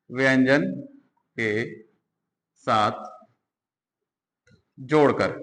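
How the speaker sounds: व्यंजन, के, साथ, जोड़कर each said apart with long pauses; slow dictation of व्यंजन के साथ जोड़कर; dictating slowly. Shown as a demonstration. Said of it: "dictated word by word".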